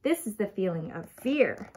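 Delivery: usual speaking voice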